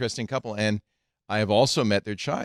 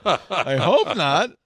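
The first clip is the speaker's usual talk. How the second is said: laughing; 6 semitones higher, 5 dB louder, slower than usual. Real, not acted. Joking after Google comparison.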